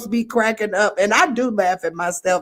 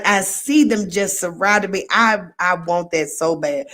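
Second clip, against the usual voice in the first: normal talking voice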